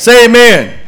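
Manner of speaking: loud, high call for an amen; call to the congregation. Real, not acted. Preacher asking the congregation to say amen, loud and high. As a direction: rouse the room to answer. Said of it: "preacher calling the congregation".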